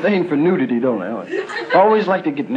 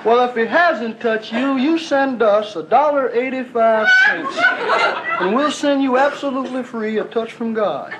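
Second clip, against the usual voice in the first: high, slow crooning radio evangelist; radio preacher; 5 semitones higher than usual. Retold or imitated. Hillbilly radio host greets Sunday listeners, high and slow. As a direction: sell God sweetly and slowly over the air.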